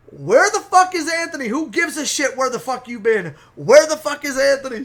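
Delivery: high, loud angry demand; pissed off. Retold or imitated. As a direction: tear into him, imitating a furious woman. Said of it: "she's fucking pissed".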